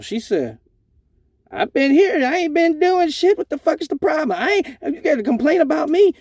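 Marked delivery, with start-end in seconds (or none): 1.5-6.1 high, defensive patient voice; indignant patient. Retold or imitated. Patient accused by fake cop, high indignant protest.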